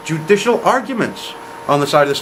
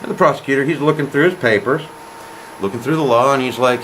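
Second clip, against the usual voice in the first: normal talking voice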